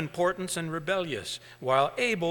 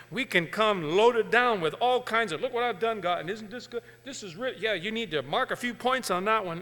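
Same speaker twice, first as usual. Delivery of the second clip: mock proud believer; 5 semitones higher than usual. Mocking a self-proud believer.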